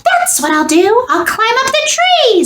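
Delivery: high, eager little squeak; squeaky mouse voice. A narrator's reading. Mouse deciding to climb the tree, squeaky and eager. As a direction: chirp the plan like a tiny mouse. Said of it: "said Mortimer".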